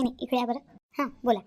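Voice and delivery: ordinary talk, nothing marked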